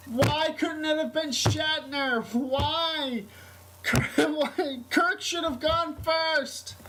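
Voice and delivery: high, slow, upset yell imitating the manager; retold shouting. Manager shouting the news at work, high-pitched mock yell. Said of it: "my managers is just shouting".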